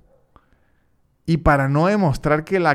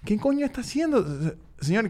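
higher, softer disbelief in his head; inner bewilderment; 8 semitones higher, 5 dB quieter than usual. Retold or imitated. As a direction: think it, baffled. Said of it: "yo en mi mente".

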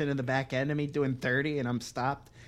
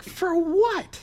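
high-pitched disbelieving question; incredulous retort. Retold or imitated. Storyteller reacting to being served, incredulous. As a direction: throw it back, voice jumping up.

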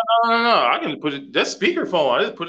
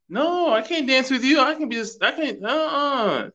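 high mock refusal; 9 semitones higher than usual. Son refusing guy's dance offer.

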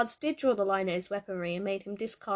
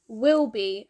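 lightly voiced child calling out, much louder; loud, lightly acted kid's shout; shrill child; 2 semitones higher, 9 dB louder than usual. A narrator's reading. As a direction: call down a hall like a child. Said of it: "a child's shrill voice rang".

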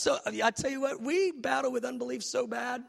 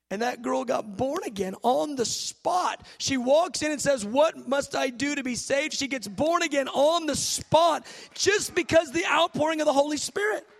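loud, raised preaching voice; fervent preaching; 5 semitones higher, 6 dB louder than usual. Real, not acted. Preacher testifies to a girl born again on the spot, fervent. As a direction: preach it with rising force, punching key words. Preacher builds to full force.

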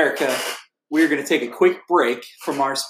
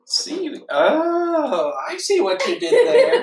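very high, swooping realization; amused cartoon sponge; 12 semitones higher, slower than usual. Sponge getting co-host's seaweed joke, high amused voice.

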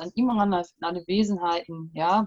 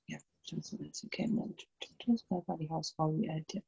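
banishing spell, hushed and low; murmured incantation; 5 semitones lower, 12 dB quieter, faster than usual. Acted. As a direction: mutter the formula under your breath. Repeats banishing formula, hushed.

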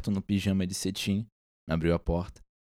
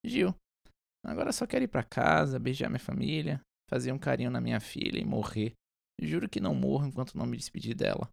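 soft, weary pleading voice; dragging voice; 3 semitones higher, 6 dB quieter than usual. Acted. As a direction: drag the words out, pleading. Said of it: "um pedido na voz arrastada".

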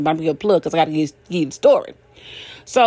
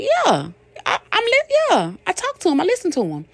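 proud answer; 13 semitones higher than usual. Retold or imitated. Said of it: "I'd be like".

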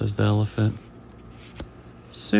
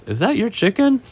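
lightly voiced inspector, high and rising; lightly acted high-pitched query; inspector's question; 9 semitones higher, 3 dB louder than usual. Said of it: "the inspector sees the chicken and says".

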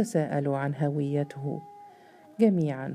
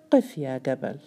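a lightly eerie, commanding call to halt; the giant stops Jabal in the dark, a hint of an uncanny voice; strange voice. A narrator's reading. A giant figure commands Jabal to stop, lightly uncanny voice. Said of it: "يقول بصوت عجيب".

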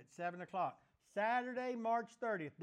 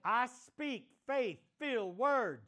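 forceful declaration; 3 semitones higher, 3 dB louder than usual. Real, not acted. Pastor leading the congregation in declaration, loud and forceful. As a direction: call it out loud and strong, line by line. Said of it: "say it like you mean it".

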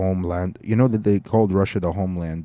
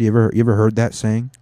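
ordinary talk

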